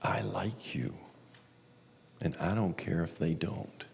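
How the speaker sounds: near-whispered voice of God; hushed, soft reassurance; little inner voice. Retold or imitated. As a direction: whisper it tenderly, leaving long pauses. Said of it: "I hear this little voice say".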